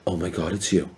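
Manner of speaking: soft, stunned recognition; retold hushed awe. Woman spotting Survivor player at a store, hushed awe. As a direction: imitate a fan saying it under her breath.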